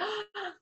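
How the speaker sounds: sharp high gasp of relief; relieved gasp. Retold or imitated. Let through immigration, she gasps in relief.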